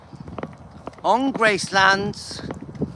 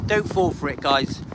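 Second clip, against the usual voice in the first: ordinary talk, nothing marked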